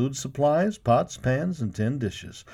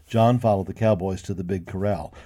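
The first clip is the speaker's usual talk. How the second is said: ordinary delivery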